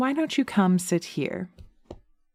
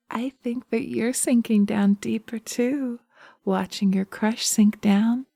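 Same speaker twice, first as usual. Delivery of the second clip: usual speaking voice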